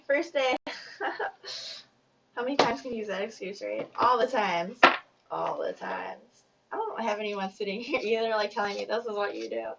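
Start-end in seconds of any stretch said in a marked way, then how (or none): 4.0-4.8 punchy, louder call of the answer; emphatic repeat. Pretend. Reader answering her own question, emphatic and loud. 5.3-6.4 much lower and slowed right down; low slow echo. Pretend. Reader echoing her answer to herself, low and slow. Repeating own joke answer.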